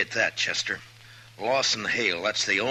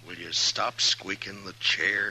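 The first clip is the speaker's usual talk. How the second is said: ordinary talk, nothing marked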